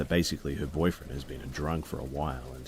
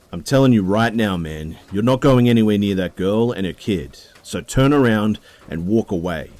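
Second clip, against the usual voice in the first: very loud, much higher yelled threat; furious shout; 7 semitones higher, 12 dB louder than usual. Retold or imitated. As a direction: shout him down, last warning. Boyfriend pushes man back, threatens.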